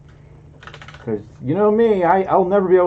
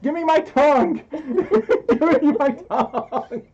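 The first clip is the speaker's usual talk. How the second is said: high, loud plea through laughter; laughing mock wail; 11 semitones higher, 4 dB louder, slower than usual. Streamer begging for the tongue back, laughing mock wail. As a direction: wail it while cracking up, stretching the end.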